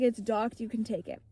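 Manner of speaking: fading dying voice. Retold or imitated. Dying Jonathan handing over his ship. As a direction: let the life drain out of it. Dying pirate's last words.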